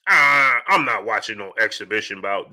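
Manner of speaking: put-on low voice of a refuser; mock refusal. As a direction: drop low to mimic dismissive fans. Mimicking fans who refuse to watch.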